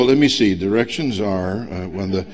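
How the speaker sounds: hammed-up unhurried perusal of the instructions; mock calm. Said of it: "I've gotta be calm enough".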